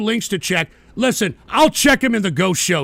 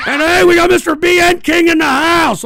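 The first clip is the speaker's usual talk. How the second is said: loud, high hype-man welcome; hype announcement; 7 semitones higher, 6 dB louder than usual. Real, not acted. Welcoming a chatter into the stream, loud hype announcement. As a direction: announce him like an MC.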